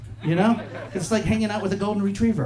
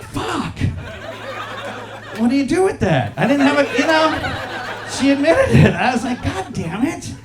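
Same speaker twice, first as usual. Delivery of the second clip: baffled that she just admitted it; flabbergasted; 7 semitones higher than usual. Retold or imitated. Comedian reacting to the driver who admits she's bad, flabbergasted.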